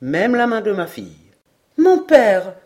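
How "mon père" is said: louder, higher gentle reproach; a daughter chiding her father, pitched up and loud; light reproach. A narrator's reading. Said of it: "avec l'accent d'un léger reproche". (léger reproche, plus fort et plus aigu)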